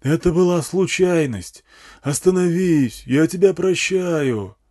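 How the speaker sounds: slow, high megaphone plea; yelling through amplifier. A narrator's reading. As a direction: plead with her across the distance. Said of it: "орал усилитель".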